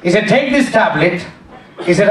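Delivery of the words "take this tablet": put-on Indian accent. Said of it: "He was an Indian doctor".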